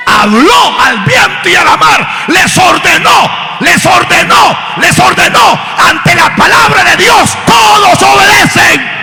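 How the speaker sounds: high, slow, shouted proclamation; full-force preaching. Real, not acted. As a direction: thunder it out phrase by phrase.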